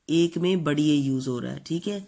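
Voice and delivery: normal talking voice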